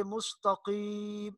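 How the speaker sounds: slow soft مستقیم with a uvular q; correct qaf. Shown as a demonstration. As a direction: draw out مستقیم with heavy ق. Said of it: "بلکہ". (مستقیم کھینچ کر، موٹا قاف)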